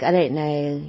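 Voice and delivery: ordinary delivery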